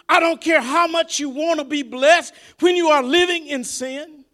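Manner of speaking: high, loud, shouted preaching to the congregation; full-force preaching. Real, not acted. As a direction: preach it hard to the folks.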